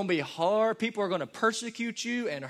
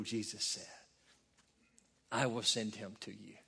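soft scripture reading; 5 semitones lower, 5 dB quieter than usual. Acted. Preacher reads scripture softly.

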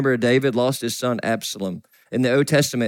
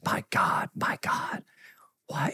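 softer, breaking whisper; broken whisper; 8 dB quieter than usual. Retold or imitated. Jesus on the cross, broken whisper. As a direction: let the words break into a whisper. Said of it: "And he's broken".

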